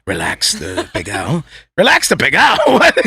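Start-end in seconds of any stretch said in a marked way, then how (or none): 0.1-1.4 very low, slow and soft; mock soothing chef. Comic imitating a chef massaging rice, soothing hush. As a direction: coax the food as if massaging it. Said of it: "acting like he's massaging this thing".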